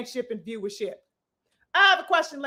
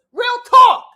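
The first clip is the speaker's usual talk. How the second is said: emphatic shout; 7 semitones higher, 11 dB louder than usual. Real, not acted.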